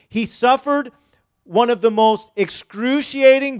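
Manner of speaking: loud, impassioned pulpit preaching; preaching at full force. Acted. Preacher to congregation about the crucifixion, loud impassioned preaching. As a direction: drive home the suffering of the cross.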